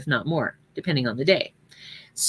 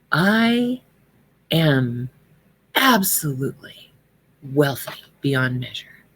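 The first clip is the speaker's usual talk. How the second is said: slow, deliberate, each word given weight; mean every word, let it sink in; with great feeling; 4 semitones lower, slower than usual. Shown as a demonstration. Showing congregation the right way, slow heartfelt declaration. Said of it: "See my posture, how it changed?".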